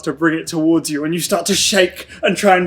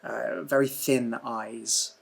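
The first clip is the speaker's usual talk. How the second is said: dreamy scene narration. Acted. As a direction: draw them into an eerie dream.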